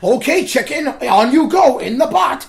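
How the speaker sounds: high, slow, loud command; bossy villain shout. Retold or imitated. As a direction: bark it like a mad scientist. Mock Eggman ordering.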